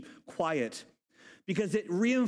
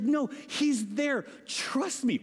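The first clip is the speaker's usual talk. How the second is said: high, drawn-out protest; insistent; 6 semitones higher, slower than usual. Acted. Rhoda to those doubting her, insistent. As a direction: insist slowly, pitched up.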